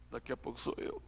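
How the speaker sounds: low inner thought. Pretend. Imagined thought at coffin.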